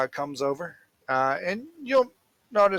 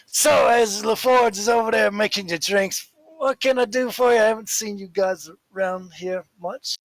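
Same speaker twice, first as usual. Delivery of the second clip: loud, higher barkeep patter; chatty barkeep; 6 semitones higher, 4 dB louder than usual. Acted. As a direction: make small talk with new customers. Barkeep chats to newcomers.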